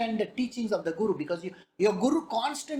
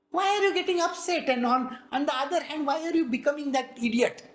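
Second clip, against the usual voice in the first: usual speaking voice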